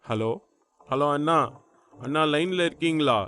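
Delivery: ordinary delivery, nothing marked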